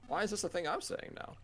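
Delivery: ordinary talk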